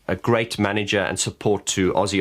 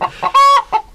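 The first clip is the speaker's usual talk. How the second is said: loud high laugh; 10 dB louder than usual. Real, not acted. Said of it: "burst of laughter".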